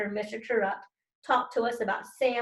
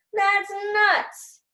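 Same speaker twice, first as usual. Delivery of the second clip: high, loud outburst; protesting shout; 10 semitones higher, 6 dB louder than usual. Acted. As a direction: blurt it out in protest. Kids protesting teacher's plan.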